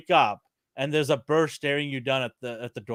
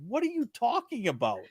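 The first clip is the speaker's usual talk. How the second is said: high, softer imitation of a baffled friend; mock disbelief; 7 semitones higher, 6 dB quieter than usual. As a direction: question it in high-pitched disbelief. Retold friend disbelieves bird attack.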